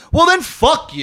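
high, loud mock outburst; a retold fake-angry brush-off, loud and high. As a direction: blurt it out high and loud, half joking. Retold snap at beggar.

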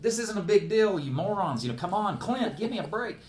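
high, incredulous griping; exasperated scolding. Retold or imitated. Acting out scolding his rescuers.

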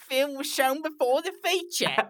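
hammed-up British pomposity; pompous British reviewer. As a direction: read it as an absurdly grand British critic. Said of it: "is this a pompous British person writing this review?".

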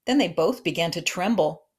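usual speaking voice

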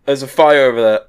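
loud in-character alert; calling out. Acted. Player's character alerts the guard to a fire, calling out loudly. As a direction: call out the warning at full volume. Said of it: "points at the fire, and goes".

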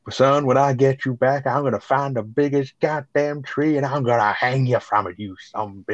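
mock sheriff threat. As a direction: play the furious sheriff as a half-joking impression. Retold sheriff threatening Bandit over radio.

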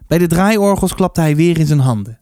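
usual speaking voice

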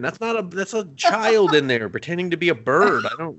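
high-pitched comic rant; frantic pleading. Retold or imitated. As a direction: plead in mounting mock alarm. Acts out frantic plea about bird.

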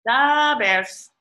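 punchy mock announcer call; announcer echo. Echoing the announcer.

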